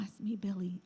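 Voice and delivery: voiceless whisper. Retold or imitated. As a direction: hush it like a secret confession. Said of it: "they will whisper out a conversation".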